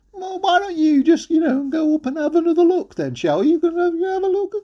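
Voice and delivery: high-pitched mum voice; coaxing mum. Acted. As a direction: nudge him sweetly in a high mum's voice. Mum coaxing Jack in the story.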